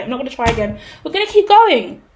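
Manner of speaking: ordinary talk, nothing marked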